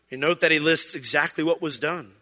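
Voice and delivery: usual speaking voice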